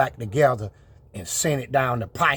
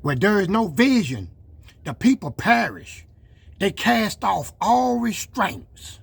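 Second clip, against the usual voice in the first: raised, preacher cadence, slowing on the last line; preaching; 8 semitones higher than usual. Acted. Truck vlogger quoting scripture to doubters, preacher style. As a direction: deliver it like a sermon from the pulpit.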